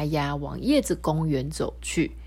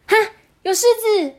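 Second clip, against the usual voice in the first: much higher, loud startled cry; 7 dB louder than usual. Acted. Mimi suddenly calling out to the group, loud. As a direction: shout out about the lion. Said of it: "突然咪咪大声地说".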